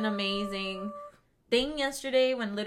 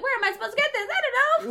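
panicked friend; 6 dB louder, faster than usual. Retold or imitated. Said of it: "She was like".

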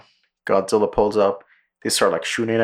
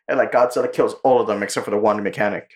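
ordinary talk

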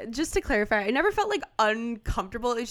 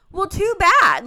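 loud, much higher, dismissive too bad; mom snapping back; 11 semitones higher, 12 dB louder, slower than usual. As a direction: shut him down like a fed-up mother. Mom overruling son.

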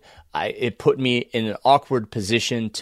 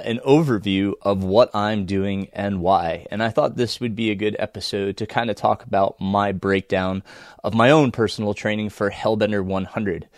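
usual speaking voice

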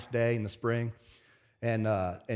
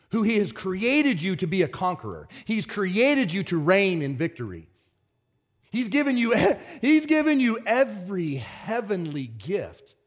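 raised pitch, slow emphatic proclamation; forceful preaching; 6 semitones higher than usual. Real, not acted. Preacher telling church they are made to conquer, forceful preaching. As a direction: proclaim it to stir up the congregation.

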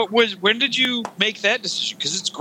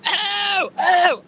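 mock yell; 12 semitones higher than usual.

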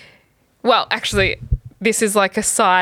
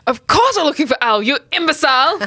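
Holt impression; 6 semitones higher than usual. Podcaster doing Holt's line, mildly angry Holt. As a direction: snap it out as stern captain Holt. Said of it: "You've got a better Holt than me".

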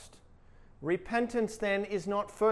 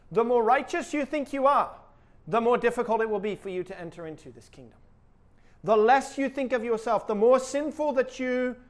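forceful preaching; 4 semitones higher, 7 dB louder than usual. Real, not acted. Preacher pressing the contrast.

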